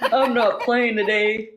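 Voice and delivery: laughing through the words, slowed down; giggling while insisting you're not joking. Real, not acted. Laughing retort to friend.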